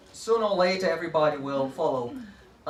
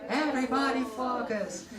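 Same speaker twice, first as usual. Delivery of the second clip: class response; 6 semitones higher, 3 dB quieter than usual. Shown as a demonstration. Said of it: "and they say".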